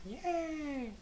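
very high, thin squeaky yay; a silly falsetto whoop; mock cheer. Cheering at finding the cows in a squeaky falsetto.

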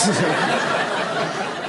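high rising laugh at his own blunder; sheepish laugh; real laugh. Real, not acted. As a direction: laugh at the embarrassment. Laughing at bagel story.

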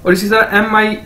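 ordinary delivery

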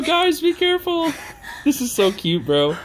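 ordinary delivery

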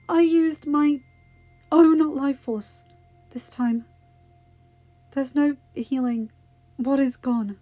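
failing, desperate Circe; dying young witch. Acted. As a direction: push the words out through pain.

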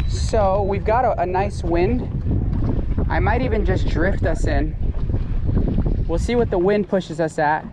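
normal talking voice

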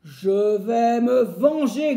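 slow, loud grumbling threat; woodcutter grumbling and growling. Acted. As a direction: growl out revenge through the teeth. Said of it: "grogne et gronde le bûcheron". (grognement lent et furieux)

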